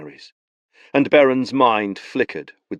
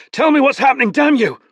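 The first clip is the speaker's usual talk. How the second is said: angry demand; 6 dB louder than usual. Acted. Tristan demanding angrily.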